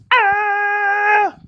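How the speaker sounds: high playful yelp; a silly high "Ah!" like a dancing skeleton. Pretend. Storyteller voicing the skeleton's wild dance move with a yelp.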